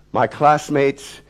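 ordinary delivery, nothing marked

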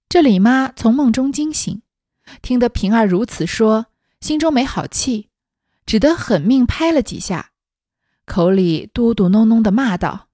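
measured, projected storybook reading; story narration. A narrator's reading. Narrator reading a classic novel to listeners, story narration. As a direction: read it out deliberately, acting the dialogue lightly.

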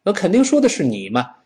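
ordinary delivery

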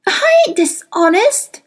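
put-on high, slow, loud girlish protest; indignant cry. A narrator's reading. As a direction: cry out against the accusation. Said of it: "Lyra cried".

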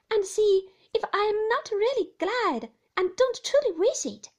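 put-on earnest boy pleading with his nurse; young David. David earnestly assures Peggotty, eager boyish voice.

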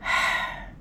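quiet, breath-only sigh; hushed exhaled sigh; soft sigh. A narrator's reading. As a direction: let out a weary sigh while composing yourself. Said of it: "赵念祖也调整情绪".

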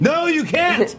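high-pitched, drawn-out protest; mock-scolding outburst. Real, not acted. Interviewer objects to writing while driving, high-pitched protest. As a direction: cut in with a high, exclaimed objection.

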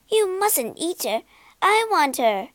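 put-on high, bossy little girl's demand; a child who always gets her way; spoiled robber girl. Robber girl stops her mother, high and bossy. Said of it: "It was the daughter of the chief robber".

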